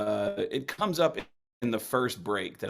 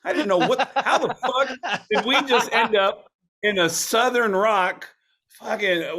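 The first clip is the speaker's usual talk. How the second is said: high, louder words broken by real laughter; laughing; 10 semitones higher, 7 dB louder than usual. Real, not acted. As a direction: laugh through the disbelief. Laughs recalling absurd moment.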